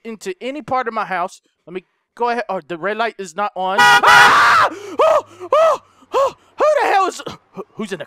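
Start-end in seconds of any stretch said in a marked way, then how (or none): 3.8-4.7 sudden high shriek of fright; startled scream. Real, not acted. Jump scare in horror game. 4.7-7.2 high, loud frightened yelps; blurting out in shock; startled panic. Real, not acted. Scared by a jump scare.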